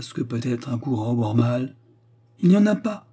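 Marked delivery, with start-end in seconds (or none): 2.4-3.1 higher, louder quick cry; lively exclamation. A narrator's reading. Anna cutting in on Axel, a quick loud cry. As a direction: blurt it out eagerly. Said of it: "s'écria vivement Anna".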